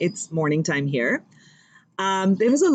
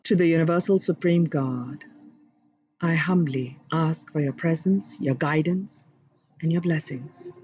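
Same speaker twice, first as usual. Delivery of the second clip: humble prayer. Acted. Host invoking God and guides for viewers, reverent prayer voice. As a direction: pray humbly and sincerely. Opening prayer to divine beings.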